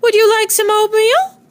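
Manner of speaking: high sing-song yoo-hoo call. A narrator's reading. Neighbor calling over the fence in a high sing-song. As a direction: call across to the neighbor brightly. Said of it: "she called out".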